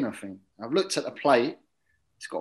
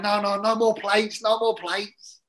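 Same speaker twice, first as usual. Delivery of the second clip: mock staff pleading; 6 semitones higher, slower than usual. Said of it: "Everyone's going".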